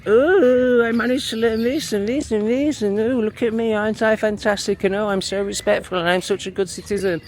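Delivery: high, smug, preening mimicry; mocking prissy voice. Mocking a viewer as a smug good citizen, voice high and preening. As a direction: ham up a self-satisfied goody-goody. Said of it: "where you're like".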